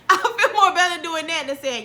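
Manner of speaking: high, loud, laughing delivery. Real, not acted. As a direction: say it through a burst of laughter. Laughing at her own super chat idea.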